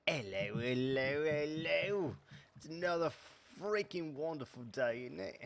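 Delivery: tentative mock Cockney greeting; Cockney accent. Gamer testing a Cockney accent on viewers, tentative mock Cockney. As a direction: try on a Cockney accent, half-sure of it. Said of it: "trying out my, uh, I don't know, British, maybe Cockney accent".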